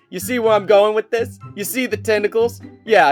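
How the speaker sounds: usual speaking voice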